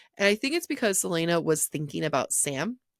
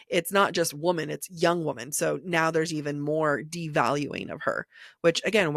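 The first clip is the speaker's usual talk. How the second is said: usual speaking voice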